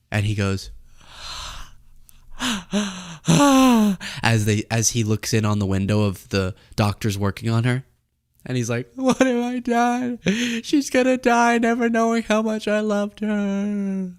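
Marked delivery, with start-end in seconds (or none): 2.3-4.2 hammed-up, high gasping sobs; mock sobbing gasps. Acting Tim at hospital window. 9.0-14.1 very high, drawn-out fake sobbing; mock grief-stricken wail. Acting grieving Tim, music surging.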